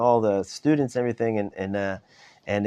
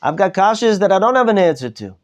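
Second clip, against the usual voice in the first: a pleading rebbe whose questions pour out louder and higher; emotional plea to God; 8 semitones higher, 4 dB louder than usual. Retold or imitated. Rebbe at the Seder pleading with God, rising to anguished cries. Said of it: "really emotional".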